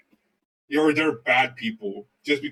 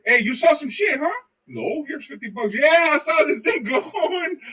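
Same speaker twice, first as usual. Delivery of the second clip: mock witness voices; 11 semitones higher, 4 dB louder than usual. Acted exchange with the Indian witness.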